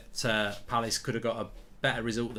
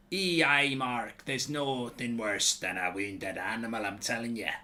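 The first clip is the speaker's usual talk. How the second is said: slow, put-on Scottish accent; mock Scottish brogue, drawn out; 2 semitones lower than usual. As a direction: play a hammy Scotsman reading a tweet. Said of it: "I gave you a Scottish accent".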